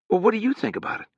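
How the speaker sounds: high and quick, earnest. Acted. Harrogate asks Sutri, high and quick. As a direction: pitch it up, hurried.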